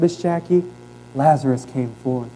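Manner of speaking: normal talking voice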